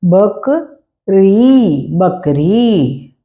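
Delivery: "बकरी" drawn out very slowly twice; slow spelled-out reading. Shown as a demonstration. Teacher repeating the word for pupils, very slowly. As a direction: sound out "बकरी" slowly, leaning on the final ई. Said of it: "क और र में ई की मात्रा".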